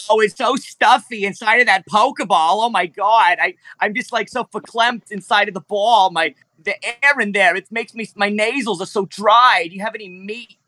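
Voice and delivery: high, nasal kvetching dinosaur voice; high whiny creature voice. Acted. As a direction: play a fussy, complaining T-Rex, pitched well up.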